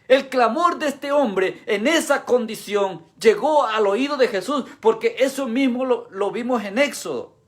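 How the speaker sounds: high, driving sermon delivery; impassioned preaching. Real, not acted. Preacher telling congregation the cry reached Jesus, impassioned. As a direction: drive the point home to the congregation.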